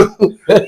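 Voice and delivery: quick high-pitched laugh; a short bright laugh. Real, not acted. Laughing mid-sentence.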